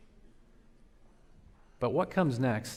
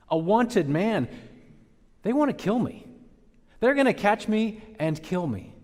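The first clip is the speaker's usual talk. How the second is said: loud, high, aggrieved protest to God; indignant complaint; 9 semitones higher, 7 dB louder than usual. Acted. Elijah protesting to God: loud aggrieved complaint. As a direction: Elijah pouring out his grievance, voice high and loud. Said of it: "full-on defense slash complaint".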